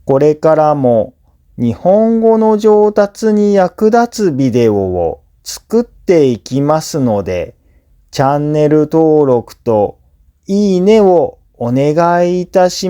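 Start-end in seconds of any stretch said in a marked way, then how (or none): none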